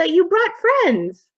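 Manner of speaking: put-on high, slow little-boy voice; ghost boy. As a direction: greet them in a high child's voice. Ghost boy greets the party.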